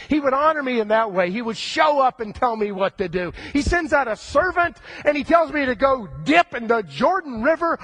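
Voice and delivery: a proud general who can't believe the insult; indignant outburst. Acted. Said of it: "Naaman goes ballistic".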